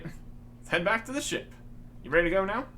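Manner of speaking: ordinary delivery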